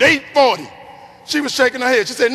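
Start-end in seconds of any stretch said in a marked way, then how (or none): 0.0-0.7 mild mock yell, raised and louder; retold scream. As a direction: blurt it out confidently, a bit loud. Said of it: "I screamed".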